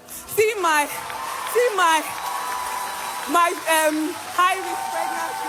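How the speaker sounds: joyful shout. Real, not acted. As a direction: cry it out in thanks. Overjoyed testimony about miracle baby.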